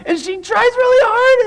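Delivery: very high, squeezed storytelling voice; straining effort. Acted. Preacher telling of girl heaving at branch, high and straining. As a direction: push it way up as if heaving.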